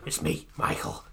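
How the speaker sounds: husky near-whisper; weird possessed voice. Retold or imitated. Medium faking Michael's ghost at a seance, husky whisper. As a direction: rasp it out, faking a dead star. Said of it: "in this weird voice".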